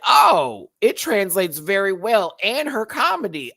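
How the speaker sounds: high, loud astonished exclamation; shocked reaction. Retold or imitated. Fan recalling surprise at the queen's English, shocked exclamation.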